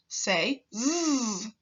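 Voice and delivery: a voiced buzz on z; drawn-out buzzy z. Shown as a demonstration. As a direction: let the z in "Say z." buzz in the throat. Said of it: "the buzzy z sound".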